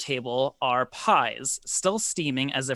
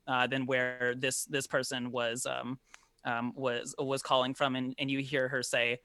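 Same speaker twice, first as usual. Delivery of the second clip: ordinary talk, nothing marked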